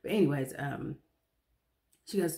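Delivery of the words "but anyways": usual speaking voice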